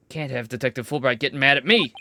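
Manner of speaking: ordinary delivery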